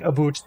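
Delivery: "a boot" with an oo, imitating Canadians; mock Canadian "a boot" for "about". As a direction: say "a boot" like a joking Canadian, vowel as oo. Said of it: "I heard him, like, saying".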